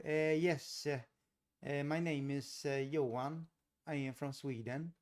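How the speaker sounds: lilting, put-on Swedish accent. Shown as a demonstration. Showing how Swedes speak English, Swedish accent. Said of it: "Swedes have a very distinct accent".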